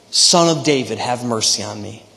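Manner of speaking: normal talking voice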